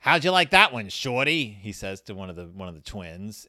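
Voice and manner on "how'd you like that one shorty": loud, mocking jeer at the smaller kid; gloating taunt. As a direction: crow over him like a schoolyard bully. Bully taunts a twin after scoring.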